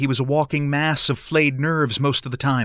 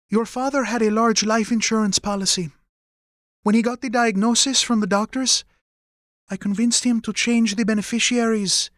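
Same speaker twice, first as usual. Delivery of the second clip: higher-pitched mother's voice; mother, explaining earnestly; 9 semitones higher than usual. Acted. Mother telling her son about the insurance money, earnest. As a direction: break the news carefully in a high voice.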